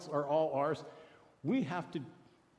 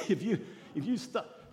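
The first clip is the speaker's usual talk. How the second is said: laughing. Real, not acted. Laughs mid-sentence.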